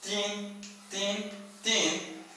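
"tin" said softly with a clipped ending; mark the tin. Shown as a demonstration. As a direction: tap out "tin" gently. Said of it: "hay que marcar el tin". (marcando el "tin" suave)

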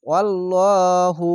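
আল্লাহু said slow with thick full ল; slow, heavy আল্লাহু; heavy Allah. Shown as a demonstration. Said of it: "আল্লাহ লেখাটি মোটা করা হবে".

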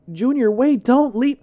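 loud, high cry after him; desperate call. Acted. Narrator pleading with fleeing Junior, high desperate yell. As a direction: shout it, begging him not to go. Friend being left behind, calling.

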